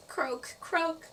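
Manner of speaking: high-pitched cartoon frog croak. A narrator's reading. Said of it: "She sounded like a frog".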